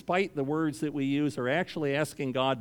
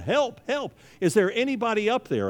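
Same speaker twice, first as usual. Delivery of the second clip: high, loud cry for help from a cliff; panicked yell for help; 9 semitones higher, 4 dB louder than usual. Acted. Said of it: "he starts yelling".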